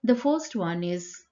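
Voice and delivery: ordinary talk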